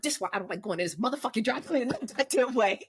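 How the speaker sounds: fast, angry grumble through gritted teeth; muttering through teeth. Retold or imitated. Driver grumbling alone about the dry cleaners, teeth clenched. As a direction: mumble furiously to yourself as you retell it. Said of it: "You talking through your teeth".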